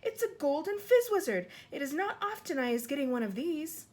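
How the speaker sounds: boasting of a rare catch; excited cry. Acted. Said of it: "he cried".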